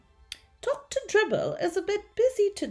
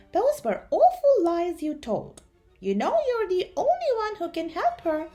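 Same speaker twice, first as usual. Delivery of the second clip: high, louder scolding; pitched-up, insistent telling-off; disbelieving reproach; 9 semitones higher, 6 dB louder than usual. A narrator's reading. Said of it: "stared at him in disbelief".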